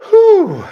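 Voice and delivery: a sudden high, loud "whew" whoop; loud, very high relieved whoop. Real, not acted. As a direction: let out a shrill release of breath. Overwhelmed after the poem.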